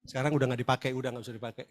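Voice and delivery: normal talking voice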